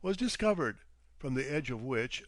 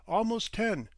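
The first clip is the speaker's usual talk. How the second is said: higher and a bit louder; announcing; 8 semitones higher, 4 dB louder than usual. A narrator's reading. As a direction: state the hour crisply. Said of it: "he announced".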